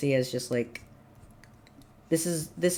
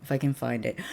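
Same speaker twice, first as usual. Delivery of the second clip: usual speaking voice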